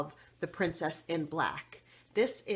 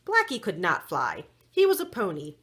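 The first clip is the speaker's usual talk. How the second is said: ordinary delivery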